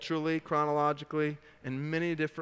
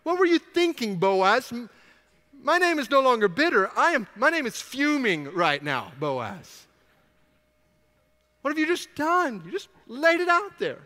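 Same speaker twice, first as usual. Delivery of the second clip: much higher, louder, a furious mock rant; livid Naomi; 7 semitones higher, 5 dB louder than usual. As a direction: Naomi scolding Boaz, hammed-up fury, higher and louder. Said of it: "she's now livid".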